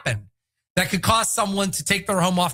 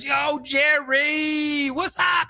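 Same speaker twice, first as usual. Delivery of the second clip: shouted, high, slow hype call; high excited shout; hyped football player; 7 semitones higher, much slower than usual. Retold or imitated. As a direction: yell it like a pumped-up athlete on the phone. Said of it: "He goes".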